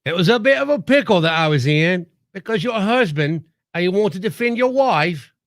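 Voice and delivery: mock Australian accent. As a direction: imitate an Australian husband explaining himself. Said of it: "gets to impersonate [name]".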